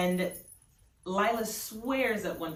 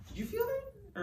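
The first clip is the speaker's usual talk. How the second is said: high, hushed and uneasy; asking Stacy, half scared of the answer; spooked question; 4 dB quieter than usual. Acted. Nervous Lila asks Stacy about the chill.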